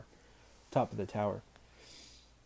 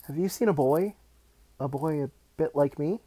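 put-on higher, louder boyish question; anxious schoolboy; 5 semitones higher, 8 dB louder than usual. A narrator's reading. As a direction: ask urgently in a boy's higher voice. Charlie questions teacher.

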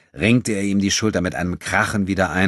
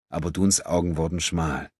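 ordinary delivery, nothing marked

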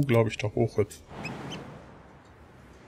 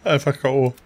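ordinary delivery

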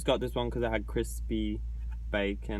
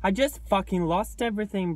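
higher, louder cry of despair; loud outburst; 7 semitones higher, 5 dB louder than usual. Real, not acted. Filming a joke goodbye video, yelling in higher, louder despair. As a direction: blurt it out like it's all over.

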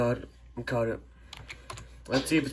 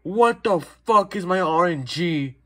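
loud, high, stunned swearing at bad luck; shocked outburst; 6 semitones higher, 5 dB louder than usual. Real, not acted. Gamer finding the wrong rare creature, shocked loud outburst. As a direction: blurt it out in disbelief, much louder and higher. Finds rare corrupt Loomian on camera.

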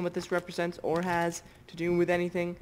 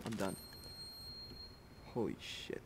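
scared whisper; 7 semitones lower, 13 dB quieter than usual. Real, not acted. Hiding in fear from monster.

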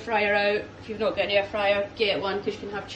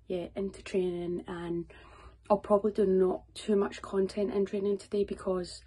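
soft, subdued chat to camera; speaking quiet; 7 dB quieter than usual. Real, not acted. Vlogger getting ready while Katie's in bed, speaking quiet. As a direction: keep the voice down so nobody wakes. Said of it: "I'm speaking quiet because".

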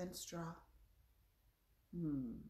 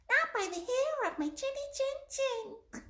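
very high, squeaky little pig; funny pig voice; 6 dB louder than usual. Shown as a demonstration. Teacher showing children the pigs' line, very high funny voice. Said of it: "in a very funny voice".

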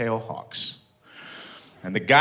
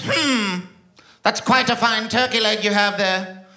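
higher, slow, sly enticing voice; mysterious pirate lady; 8 semitones higher than usual. Retold or imitated. Pirate lady tempting the comic for turkey: sly enticing voice. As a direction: lure him in like a sideshow mystic.

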